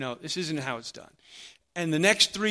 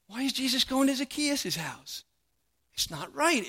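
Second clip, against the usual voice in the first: higher mock indignant grumble; grumbling crowd; 8 semitones higher than usual. The crowd grumbles about Jesus visiting Zacchaeus, indignant. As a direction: complain that it isn't right. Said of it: "The crowd would grumble".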